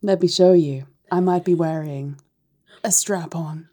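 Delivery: softening, teasing reveal; lightly acted, hushed put-on accent; Martha May. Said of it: "Martha May".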